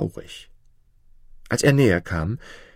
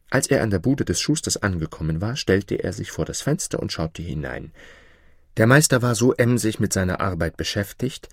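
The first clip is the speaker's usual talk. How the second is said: ordinary delivery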